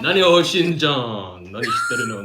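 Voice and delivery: loud, high Japanese genie voice. Acted. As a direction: bark it like an anime genie. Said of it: "Japanese genie".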